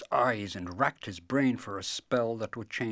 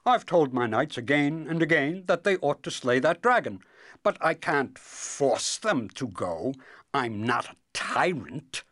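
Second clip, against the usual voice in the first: protesting king; 7 semitones higher, 7 dB louder than usual. Acted. Voices the king.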